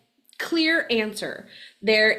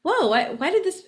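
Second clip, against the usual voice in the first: much higher, stumbling whoa why; hearing the voicemail, a startled high whoa; 9 semitones higher than usual. Retold or imitated. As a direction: caught off guard, words tripping. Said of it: "and I was like".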